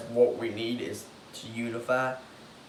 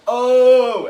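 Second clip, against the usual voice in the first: much higher, louder excited shout; mock crowd pop; 11 semitones higher, 14 dB louder than usual. Fans reacting to a cool spot, loud excited shout. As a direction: gasp out a big crowd reaction. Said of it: "we're gonna be like".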